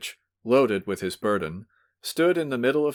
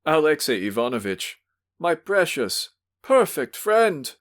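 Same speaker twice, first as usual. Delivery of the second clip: put-on drunken sentimental cry; 7 semitones higher, 4 dB louder than usual. Drunk visitor begging his host, sentimental cry. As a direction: implore forgiveness, drunk and weepy. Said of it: "he kept crying out".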